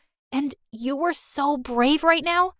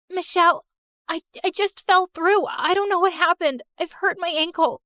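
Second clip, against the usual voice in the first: normal talking voice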